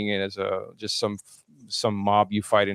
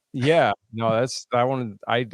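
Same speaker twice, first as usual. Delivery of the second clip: normal talking voice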